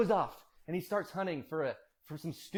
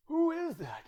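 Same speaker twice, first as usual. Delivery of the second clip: put-on much higher, wavering old father; old man's voice; 12 semitones higher than usual. Blind Isaac asking who came in, old man's voice. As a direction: question it in a high aged voice. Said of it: "I might use his old voice".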